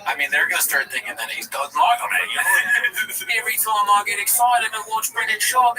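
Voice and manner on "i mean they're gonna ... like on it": normal talking voice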